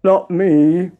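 loud, put-on frog moan. A narrator's reading. Frog refusing to fetch butter, moaning. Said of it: "moaned the Frog".

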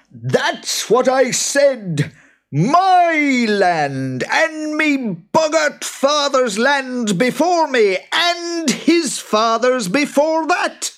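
very high, slow, loud creature voice; indignant boggart. Acted. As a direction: insist like an outraged boggart. Boggart arguing.

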